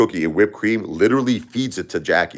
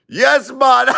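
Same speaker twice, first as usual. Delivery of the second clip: high, loud Jamaican yes man; Jamaican accent; 5 dB louder than usual. Retold or imitated. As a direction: answer the phone like a Jamaican host. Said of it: "Jamaican guy".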